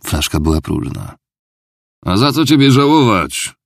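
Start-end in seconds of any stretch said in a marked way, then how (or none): 2.1-3.5 put-on higher, louder taunt at the drunk; mocking shout. A narrator's reading. Tavern keeper jeers at the drunk clerk, shouting. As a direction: jeer across the tavern. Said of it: "krzyknął gospodarz".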